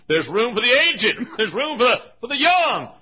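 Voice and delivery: high, shouted altar call; full-force preaching. Real, not acted. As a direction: cry out the invitation to the crowd. Invitation builds to full force.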